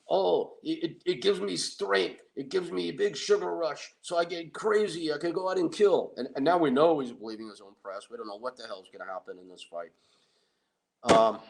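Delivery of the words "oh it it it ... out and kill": cocky Tony voice. Retold or imitated. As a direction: play the dim strongman justifying dessert. Tony explains his sundae.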